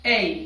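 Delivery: A said as a clear ei glide, teacher model; letter A model. Shown as a demonstration. Teacher models A for students to repeat. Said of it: "Listen and repeat".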